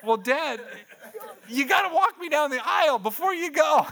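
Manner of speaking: loud, high-pitched mock plea; hammed-up, high daughter voice; imitated pleading daughter. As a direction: wail it like an insistent teen. Daughter pleading with dad, retold.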